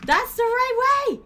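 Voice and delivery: high, loud, slow yell of triumph; excited shout. Real, not acted. Streamer yells at her character, loud and high. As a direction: belt it out, pitch way up. Yelling at the game.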